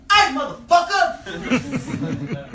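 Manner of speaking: big, drawn-out high yell; traditional yell. Retold or imitated. Comic hailing a horse rider from his car, loud yell. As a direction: holler it slowly out the window. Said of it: "do my traditional yell".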